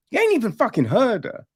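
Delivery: high, fast, incredulous jab; answering the gushing characters, exasperated; exasperated retort. Retold or imitated. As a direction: snap it back quickly in disbelief.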